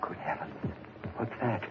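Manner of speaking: a hushed, horrified whisper at the sound. Acted. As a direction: gasp it under your breath. Hears the beating heart.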